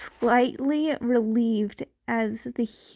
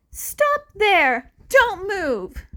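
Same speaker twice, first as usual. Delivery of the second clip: higher, louder barked order to halt; commanding shout; 10 semitones higher, 5 dB louder, slower than usual. A narrator's reading. Hero orders the advancing villain to halt, shouting. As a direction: stop him in his tracks.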